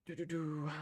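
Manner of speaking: ordinary delivery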